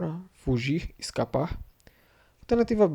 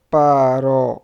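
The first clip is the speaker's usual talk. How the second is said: syllable by syllable; 10 dB louder than usual. Shown as a demonstration. Teacher breaking a word down for students, syllable by syllable.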